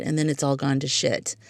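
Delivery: ordinary talk, nothing marked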